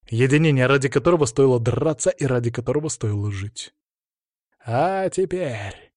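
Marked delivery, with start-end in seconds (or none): 4.6-5.9 very slow, drawn-out, high booming call; arena announcer. Acted. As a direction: stretch every word like a ring announcer. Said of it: "мощным голосом взревел ринг-анонсер".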